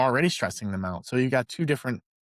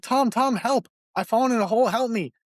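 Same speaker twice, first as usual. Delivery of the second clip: cry for help; 8 semitones higher than usual. Retold or imitated. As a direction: shout up for help, mock-desperate. Man calls up to buddy.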